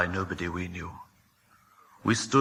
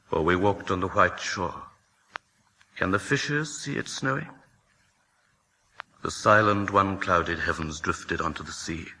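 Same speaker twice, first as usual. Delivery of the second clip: usual speaking voice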